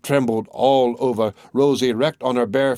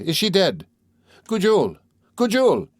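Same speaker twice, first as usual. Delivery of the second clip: high question, then higher-pitched calls; alarmed calling; 8 semitones higher than usual. A narrator's reading. Gervais fearing the recluse is dead, calling in alarm. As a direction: call her name with climbing pitch and alarm.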